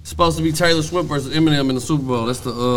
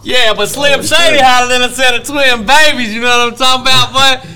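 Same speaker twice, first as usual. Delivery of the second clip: excited hype; 9 semitones higher, 9 dB louder than usual. Real, not acted. Hyping [name] loudly.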